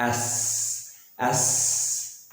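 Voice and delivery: एस ending in a long hiss of air; एस with no 'sa' after it; airy final S. Shown as a demonstration. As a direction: let a little air out on the s of एस. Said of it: "थोड़ी सी हवा निकलनी चाहिए".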